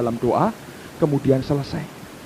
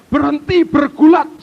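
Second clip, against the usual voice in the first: high, loud exclamation; raised, urgent preacher's command; commanding preaching; 11 semitones higher, 8 dB louder than usual. Real, not acted. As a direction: order it forcefully to the congregation.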